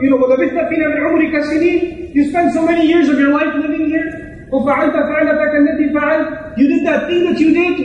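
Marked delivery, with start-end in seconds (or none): 0.2-2.1 rapid recitation. Acted. Rapid Quran quote.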